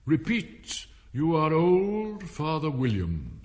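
commanding caterpillar. A narrator's reading. Caterpillar ordering Alice to recite, slow and commanding. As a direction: draw out OLD imperiously.

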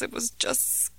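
softly whispered, scared; whispered fear. Acted. Frightened boy whispers to his mom about the dream. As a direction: breathe it out in fear.

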